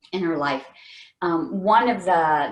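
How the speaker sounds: ordinary talk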